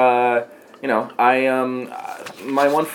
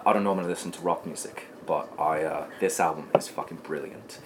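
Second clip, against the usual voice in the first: put-on a light, quieter British accent on the friend's words; 5 semitones lower, 8 dB quieter than usual. Asked to redo his friend's line, a light British accent. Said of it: "Could you do it in a British accent?".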